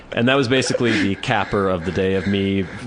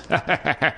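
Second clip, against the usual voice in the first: imitated cackle; 6 semitones higher, 3 dB quieter than usual. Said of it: "starts laughing and cackling".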